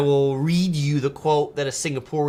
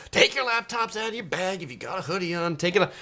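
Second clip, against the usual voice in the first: hammed-up checkpoint orders, pitched higher; mock TSA agent; 6 semitones higher than usual. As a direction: imitate an officer reeling off orders. Acting out TSA agent barking orders.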